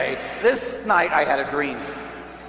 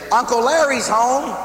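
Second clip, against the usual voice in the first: higher and bright call out; sister announcing; 8 semitones higher, 5 dB louder than usual. Retold or imitated. Sister announces Uncle Larry to the niece, higher call. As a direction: sing it out to the little girl. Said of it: "my sister would sit there and say".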